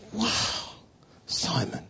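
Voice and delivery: soft, breath-only wonder; hushed amazement. Acted. Jesus answers Simon's confession, hushed amazement. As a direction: let the words out in an awed whisper.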